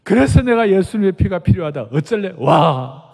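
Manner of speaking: loud, bold dare to the demon; forceful preacher's defiance; defiant challenge. Retold or imitated. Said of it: "그러라고 그랬어요".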